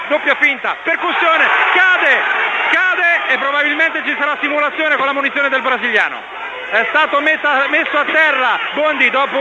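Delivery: quick rising live call; excited play-by-play. Acted.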